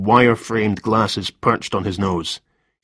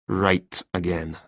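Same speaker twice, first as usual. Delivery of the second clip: hint of bitterness; 3 semitones lower, 7 dB quieter, slower than usual. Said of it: "A hint of bitterness had crept into Thompson's voice".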